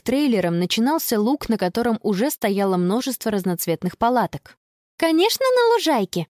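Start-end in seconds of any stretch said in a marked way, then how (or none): 5.0-6.3 put-on high-pitched girl's cry; excited yell. A narrator's reading. Girl choosing the meadow for tent, excited yell. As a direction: cry out eagerly, pitch right up. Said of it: "завопила Конни".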